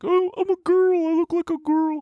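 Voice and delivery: very high mock-girl falsetto; mock your younger self in a squeaky-high girl voice; self-conscious girly voice. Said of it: "feeling really self-conscious about it".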